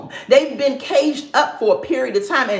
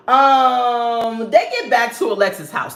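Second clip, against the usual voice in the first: normal talking voice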